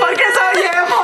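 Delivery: hammed-up sob shout; mock crying. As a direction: fake wail for laughs. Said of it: "así de que toda triste".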